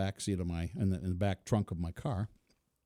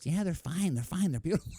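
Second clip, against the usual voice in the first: imitating fiancee; 8 semitones higher than usual. Fiancee brushing him off.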